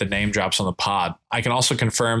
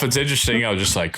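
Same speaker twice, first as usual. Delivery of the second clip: ordinary delivery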